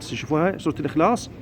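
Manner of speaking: normal talking voice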